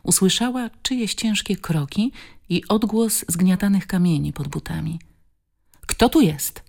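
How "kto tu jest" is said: frightened shout. A narrator's reading. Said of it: "krzyknęła wystraszona".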